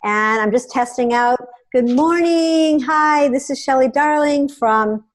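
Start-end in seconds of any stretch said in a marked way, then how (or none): 1.7-5.0 high, drawn-out cheery hello; broadcast greeting. Shown as a demonstration. Host greeting viewers as a live test starts, drawn-out greeting. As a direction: greet the viewers slowly, pitched up.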